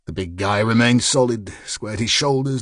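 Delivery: ordinary delivery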